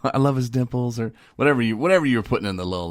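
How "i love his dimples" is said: mock gushing. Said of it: "You're like".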